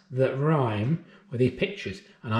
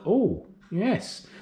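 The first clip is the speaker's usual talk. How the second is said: ordinary talk, nothing marked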